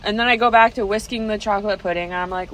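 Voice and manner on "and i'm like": ordinary talk, nothing marked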